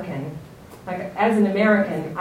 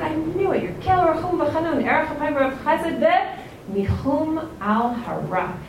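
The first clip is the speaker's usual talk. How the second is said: angry quote; 7 semitones higher than usual. Retold or imitated. Yonah bitterly reproaches God with the verse. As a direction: accuse God with his own praise. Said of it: "He's so angry".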